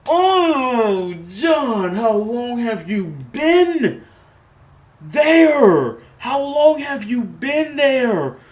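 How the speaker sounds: high, loud, slowed astonishment; stunned, stretching every word; shocked disbelief. Real, not acted. Shocked reaction to John's return.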